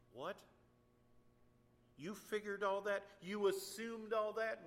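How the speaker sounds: loud, high, slow incredulity; indignant preacher; indignant disbelief. Real, not acted. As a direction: throw their excuse back at them. Indignant at rescuers' excuse.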